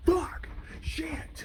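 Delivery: high, loud curses at the miss; furious yell. Acted. The alligator yelling as the zebra escapes. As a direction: blow up at losing the meal.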